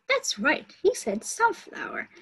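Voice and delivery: praising teacher. Acted.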